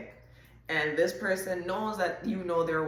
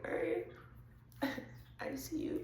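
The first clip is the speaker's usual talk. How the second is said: giggly admirer; 11 semitones higher, 9 dB quieter than usual. Retold or imitated. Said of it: "the person is like".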